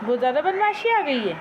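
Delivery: pretend-stern owner scolding her parrot; mock scolding. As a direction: tell off the pet in mock anger, half teasing.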